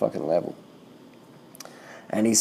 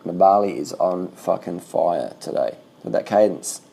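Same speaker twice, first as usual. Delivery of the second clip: ordinary talk, nothing marked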